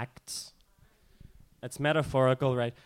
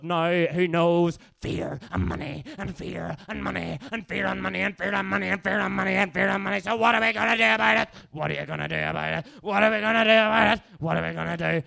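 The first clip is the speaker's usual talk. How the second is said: accelerate into a shouted call and answer; spoken-word performance; 6 semitones higher, 4 dB louder than usual. Acted. Accelerating chant to shouted question.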